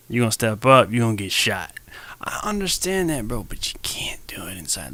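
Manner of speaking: hushed whispered warning. Real, not acted. Vlogger warning the gunman in a whisper. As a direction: drop to a whisper, serious.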